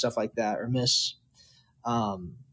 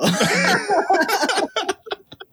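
long high laugh. Real, not acted. Laughing at own brag.

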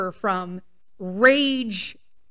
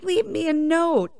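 higher-pitched mocking husband voice; mock-husband whine, pitched up; mimicked husband; 6 semitones higher than usual. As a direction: lightly parody a demanding husband. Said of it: "husbands where they're like".

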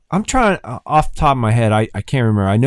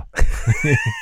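burst of laughter. Real, not acted. Recalling the crashed plane.

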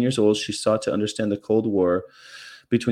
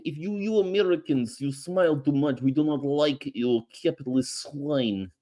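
hammed-up Russian accent, pitched up; mock Russian; 5 semitones higher than usual. Reading the letter as a mock Soviet official. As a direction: play a cartoon Soviet scold.

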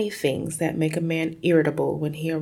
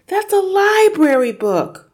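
high, loud, distressed cry; cried out; 10 dB louder than usual. Acted. Girl crying out as her library book is torn. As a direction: cry out in alarm to stop him. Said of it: "Matilda cried".

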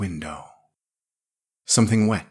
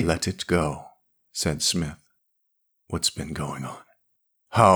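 low quiet Smith; 3 semitones lower, 3 dB quieter than usual. A narrator's reading.

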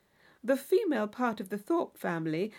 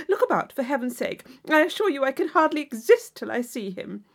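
put-on louder, breathless society-girl chatter; gushing Isabella; 6 dB louder than usual. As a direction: gush at her like an excitable young lady.

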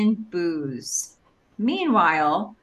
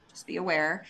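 ordinary talk, nothing marked

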